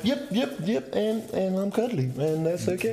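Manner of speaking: very high put-on voice, near laughter; squeaky, comic self-reassurance; mock self-acceptance. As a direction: answer your reflection high and playfully, breaking into laughter. Acted cheerful answer to mirror.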